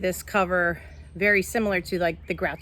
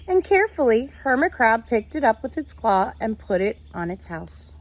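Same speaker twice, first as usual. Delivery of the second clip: put-on reading to children; 3 semitones higher, 3 dB louder than usual. A narrator's reading. Teacher reading a picture book to her class, lively storytelling. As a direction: read it like story time for the class.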